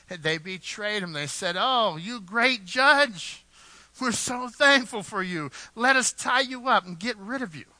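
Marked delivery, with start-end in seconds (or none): 1.6-7.7 raised, loud, exaggerated praise with a drawn-out opening; mock gratitude. Preacher voicing Samson's betrayers as they turn on him, mock gratitude. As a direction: gush loudly with fake thanks, pitched up.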